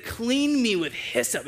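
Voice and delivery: high, drawn-out cry to God; impassioned plea. Retold or imitated. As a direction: beg to be cleansed, slow and high. David begging God to cleanse him.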